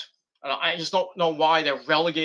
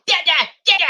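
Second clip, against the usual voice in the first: put-on high babbling baby, pitched way up; 13 semitones higher, 6 dB louder than usual. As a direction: babble it like an infant calling for dad. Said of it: "the body's going".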